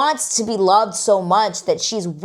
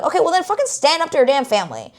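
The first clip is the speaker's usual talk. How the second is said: exasperated retort; 9 semitones higher, faster than usual. Retold or imitated.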